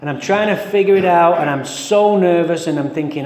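ordinary talk, nothing marked

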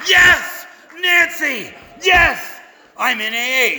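very high, exultant cries; elated cheering. Acted. Member cheers Nancy as the lights go on, elated cheering. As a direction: shout it out in hammed-up joy. Acted elation as lights went on.

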